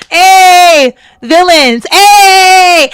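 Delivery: high, loud cheering call; cheering shout. Real, not acted. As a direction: hype up the chat like a crowd. Cheering the team name.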